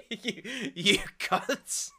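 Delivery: slow, soft, through laughter; laughing mock line. Mimicking the president's punchline, slow and laughing. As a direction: drag it out while laughing.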